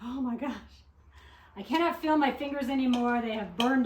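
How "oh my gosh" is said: ordinary talk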